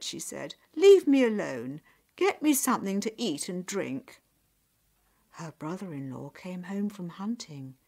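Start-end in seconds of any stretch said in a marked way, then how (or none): 0.7-4.2 bossy and forceful; irritable order. Acted. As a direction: push them away with your voice. Pushes children away, demands food.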